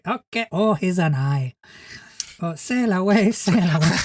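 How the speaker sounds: put-on lightly done Spanish accent, dreamy; a hint of a Spanish-accented monster humming along. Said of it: "I'm giving him a Spanish accent".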